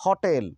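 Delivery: "होटल" with open 'haw' and long 'tel'; correct pronunciation. Shown as a demonstration. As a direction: say "होटल" as haw-TEL. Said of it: "हम इसको होटल बोलेंगे". ("होटल" को 'हॉटेल' बोलें, हल्का खुला 'ऑ')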